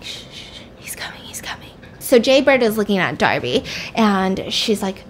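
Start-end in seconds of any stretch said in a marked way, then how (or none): 0.0-1.7 acted-out hissed whisper, very soft; urgent hush. She warns Jay that he's coming, hushed whisper. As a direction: shush them in a secretive whisper. Hushing captive while attacker nears.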